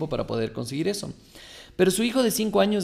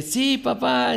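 put-on higher, eager little boy answering his dad; child voice; 9 semitones higher, slower than usual. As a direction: play the five-year-old, keen and bright. Child answers father in story. (voz de niño entusiasmado)

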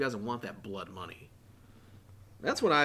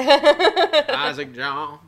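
laughing drawl; 12 semitones higher, 12 dB louder, much slower than usual. Acted. Joking full-name drawl.

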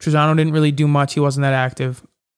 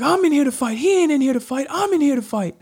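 high, defiant chant; fired-up declaration; 11 semitones higher than usual. Retold or imitated. Fighter shouting back at his coach, fired up and high. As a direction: declare you came to fight.

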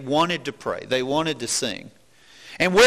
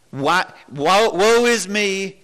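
mock lament; 3 semitones higher, 4 dB louder, slower than usual. Mock lament of a self-pitying prisoner.

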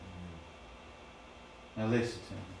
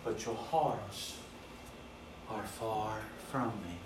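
soft voice of God; 7 dB quieter than usual. Acted. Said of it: "He said".

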